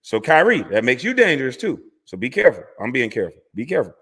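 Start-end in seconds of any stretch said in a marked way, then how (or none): none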